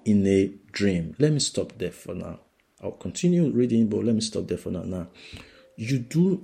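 ordinary talk

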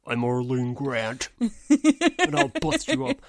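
slow, starts low then bursts high and loud; menacing alter ego hamming it up; tough Arlene voice. Pretend. Hypnotized spy as Arlene threatening someone, slow and hammed-up. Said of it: "Arlene is low voice".

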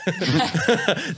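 high burst of laughter. Real, not acted. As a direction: laugh out loud at yourself. Laughing at own spending.

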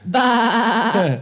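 sheep bleat. Acted. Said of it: "Do your sheep now".

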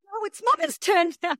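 high-pitched mock-childish retort; playfully insistent mum. Mum answers her toddler over whose turn to sing, playful high insistence. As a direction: argue back like a kid, pitched high. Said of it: "And I'm like".

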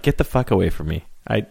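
ordinary talk, nothing marked

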